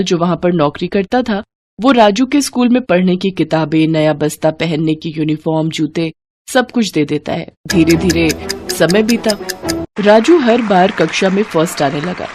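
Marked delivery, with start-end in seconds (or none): none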